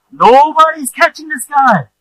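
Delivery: alarmed disbelief, burst out; excited exclaim. Real, not acted. Preacher telling congregation, high loud alarmed disbelief. Exclaims nobody catches fallen man.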